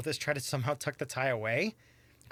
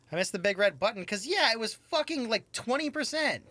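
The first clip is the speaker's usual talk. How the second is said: loud, high-pitched exasperation; real annoyed gamer rant; frustrated outburst; 10 semitones higher, 4 dB louder than usual. Real, not acted. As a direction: vent about the missed roll. Frustrated after failing a dice roll.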